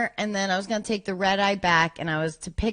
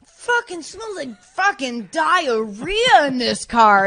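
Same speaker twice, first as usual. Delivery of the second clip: slow, drawn-out mock complaint, louder; Norm's disgusted complaint; 6 semitones higher, 6 dB louder, slower than usual. Retelling Norm smelling the car, slow disgusted grumble. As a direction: drag out the disgust on diarrhea. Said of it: "And he's going".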